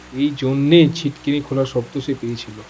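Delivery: ordinary delivery, nothing marked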